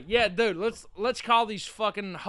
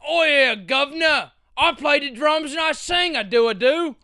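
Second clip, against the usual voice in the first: goofy Cockney, pitched up; dumb British accent; 6 semitones higher, 4 dB louder than usual. Retold or imitated. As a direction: ham up a bad British accent. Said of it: "talking with a dumb accent".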